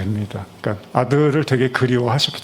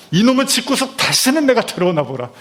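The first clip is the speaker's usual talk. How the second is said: pitched high, spitting out the threat; a son's furious vow never to return; angry outburst; 10 semitones higher than usual. Acted. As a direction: storm out of the house in rage. Brother's imagined outburst voiced.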